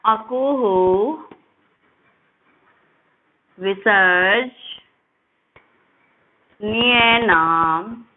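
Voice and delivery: drawn-out syllables, long pauses between words; slow recitation. Shown as a demonstration. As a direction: recite the formula slowly for students to repeat. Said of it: "मेरे साथ में आप उसको बोल लोगे". (धीरे-धीरे खींचकर सूत्र-पाठ)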